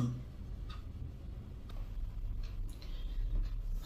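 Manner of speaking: long unvoiced heavy sigh. Troubled wife sighs heavily, alone. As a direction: let out a weary sigh.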